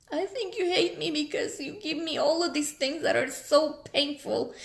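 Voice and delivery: real tearful hurt, voice cracking; teary, crackly voice. Real, not acted. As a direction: plead it through tears. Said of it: "emotional and teary and my voice sounds crackly".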